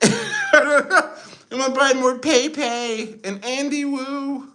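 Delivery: very high, slow mock leader voice; Xi Jinping. Streamer imagines Chinese leader buying coins, high slow caricature. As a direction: squeak it out slowly as a silly caricature. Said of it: "Xi Jinping's like".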